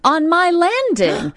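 high, slow, stressed landing; surprised. Retold or imitated. Telling her friend he was right there, surprised. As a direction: land on landing with disbelief.

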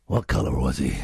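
very low Northern Irish impression; Liam Neeson. Host imitating a film star, deep Northern Irish accent. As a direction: drop right down into an Irish growl. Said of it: "That's a great Liam Neeson".

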